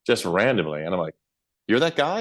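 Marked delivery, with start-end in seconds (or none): 1.7-2.2 much higher, starstruck disbelief; surprised recognition. Retold or imitated. Fan spotting a famous doctor in a coffee shop, surprised. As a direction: gasp it out like you can't believe it's him. Fan spots his hero in café.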